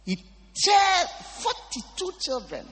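high, loud, slowed shout; thundering slow proclamation; preaching shout. Real, not acted. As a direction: drive each word home.

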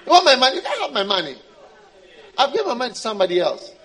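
loud refusal through laughter; laughing brush-off. Acted. God laughing off the believer's begging. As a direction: laugh them off while saying no.

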